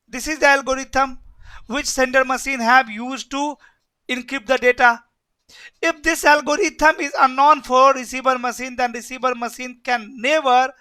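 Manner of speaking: usual speaking voice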